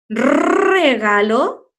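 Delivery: "regalo" with a very long rolled first R, rising at the end; strong initial R. Shown as a demonstration. Teacher asking which R it takes, long trill. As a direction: stretch the opening trill of "regalo", questioning. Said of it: "al principio cuando era fuerte".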